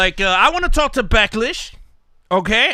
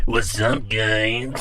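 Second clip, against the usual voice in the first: much lower, creepy caller voice; 12 semitones lower, 4 dB quieter, much slower than usual. Retold or imitated. Host imitating a creepy caller with a lowered voice. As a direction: play the creep on the phone. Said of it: "He'll be like".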